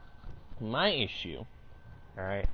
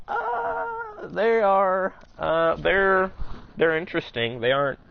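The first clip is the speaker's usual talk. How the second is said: awkward hesitation; 7 semitones higher, 6 dB louder, slower than usual. Retold or imitated. Awkward hesitant reply to coworker.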